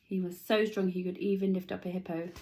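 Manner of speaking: usual speaking voice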